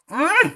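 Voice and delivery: high delighted mmm of taste; savoring hum. Real, not acted. First sip of the soup. (gumaman nikmat)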